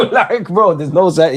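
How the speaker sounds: laughing loudly through the words; cracking up mid-sentence. Real, not acted. Streamer laughing at chat's grey or bald question, laughing loudly.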